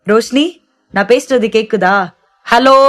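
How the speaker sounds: loud calls building to a shouted hello; calling out loudly. Acted. Tina checks if Roshni is still on the line, calling out. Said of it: "கத்தாத".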